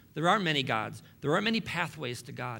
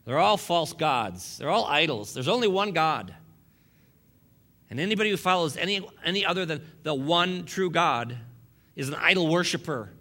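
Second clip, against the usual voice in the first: prophet preaching; 4 semitones higher, 5 dB louder than usual. Acted. Pastor plays prophet to his congregation, booming. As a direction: thunder it like an Old Testament prophet. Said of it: "I just feel like a prophet".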